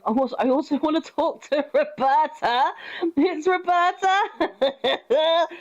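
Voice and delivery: high, laughing and drawn out; real giggly excitement; giddy excitement. Real, not acted. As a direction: squeal her name with delight. Excited at seeing Roberta.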